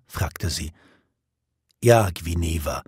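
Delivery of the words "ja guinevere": low, sorrowful answer; heavy-hearted, lowered voice; sad reply. A narrator's reading. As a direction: answer her gently and sadly. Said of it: "antwortete er traurig".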